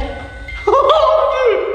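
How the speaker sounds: high-pitched laughing cry of amazement; amazed laughing gasp. Real, not acted. Investigator reacting to the spirit's apparent answer, amazed laugh. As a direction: let out a long, high, laughing whoop.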